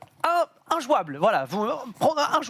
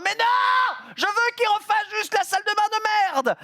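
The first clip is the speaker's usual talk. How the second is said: exasperated shout; 13 semitones higher than usual. Real, not acted. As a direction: yell it out in frustration. Exasperated outburst at the landlady.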